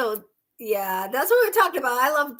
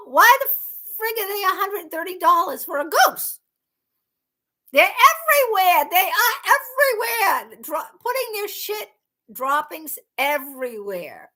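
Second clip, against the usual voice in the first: high-pitched, indignant complaint; exasperated rant; 8 semitones higher, 3 dB louder than usual. Real, not acted. Streamer ranting to viewers about costly geese, exasperated. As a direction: protest it in high, exasperated disbelief.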